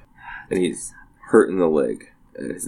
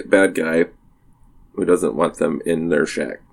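ordinary talk

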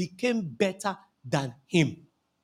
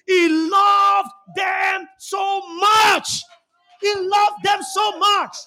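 slow, loud, stretched-out cry; preacher crying out God's love to the church; preaching at full force; 9 semitones higher, 6 dB louder, much slower than usual. Acted. Repeated slow shouted climax.

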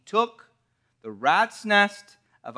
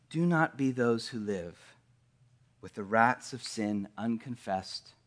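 softer, intimate sermon voice; soft reflective preaching; 7 semitones lower, 7 dB quieter than usual. Acted. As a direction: draw the congregation in quietly. Quiet reflective sermon passage.

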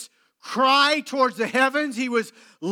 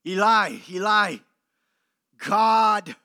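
Eli, Eli spoken, then God hit louder and held; emphasis on God; 3 dB louder, slower than usual. Shown as a demonstration. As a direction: lean hard on the word God. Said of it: "note the emphasis on the word God".

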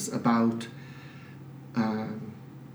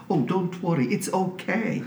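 higher, gently comforting lady; loving reassurance; 7 semitones higher than usual. Retold or imitated. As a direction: imitate someone tenderly soothing an embarrassed man. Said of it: "looked at me lovingly and she said".

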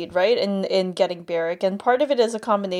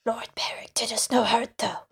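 hushed gossip; 3 semitones higher than usual. A narrator's reading. Villagers gossiping, whispered aside.

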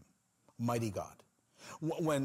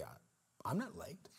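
hushed, voiceless whisper as Jesus; 9 dB quieter, slower than usual. Acted. Jesus whispering his answer to the grieving sisters. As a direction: breathe the words gently, almost no voice.

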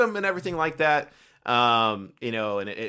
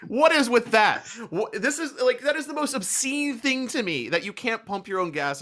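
exasperated disbelief; 12 semitones higher than usual. Real, not acted. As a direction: protest at how absurd it is. Ranting about a gas rule.

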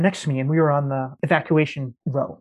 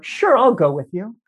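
higher and louder, a breezy joking sure; joking offhand yes; 6 semitones higher, 6 dB louder than usual. Retold or imitated. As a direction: toss it off as a joke you don't mean. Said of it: "I jokingly said to her".

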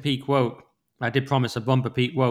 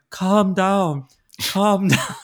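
higher mock old-lady scolding; mocking elderly woman; 6 semitones higher, 4 dB louder, much slower than usual. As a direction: mimic her as an old lady telling someone off. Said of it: "She sounds like sixty, seventy years old".

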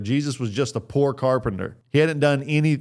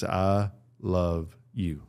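slow, soft and hushed; slow, quiet, tender words; tender I love you; 3 semitones lower, 6 dB quieter, much slower than usual. Shown as a demonstration. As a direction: say it gently like to your child. Quoting three words tenderly.

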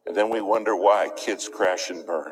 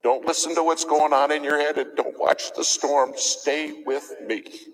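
pleading higher; 7 semitones higher than usual. Retold or imitated. Preacher voicing controller.

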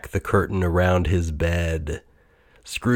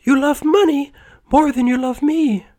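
put-on very high, sad girl's voice; lovely girl refusing to marry young Scrooge; regretful young woman; 4 dB louder than usual. A narrator's reading. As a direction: break it to him sadly. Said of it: "she said regretfully".

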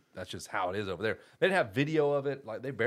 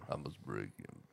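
low, deep Russian boxer voice; mock Drago voice; 11 semitones lower, 13 dB quieter than usual. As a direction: growl it low like Ivan Drago. Drago quote from Rocky IV.

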